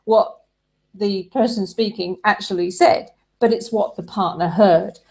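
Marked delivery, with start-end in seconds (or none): none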